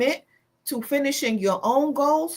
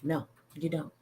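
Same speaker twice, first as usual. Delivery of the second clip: quiet aside; 7 semitones lower, 11 dB quieter than usual. Acted.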